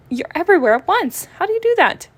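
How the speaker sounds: high, loud, baffled questions; amazed. Acted. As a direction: sound totally bewildered. Amazed at cat everywhere.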